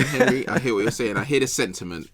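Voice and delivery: normal talking voice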